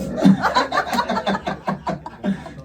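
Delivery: high breathy wheeze of laughter; high wheezing giggle. Real, not acted. Laughs at own joke.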